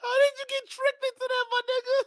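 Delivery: very high laughing question; laughing squeak. Real, not acted. Streamer asking the guest through helpless laughter.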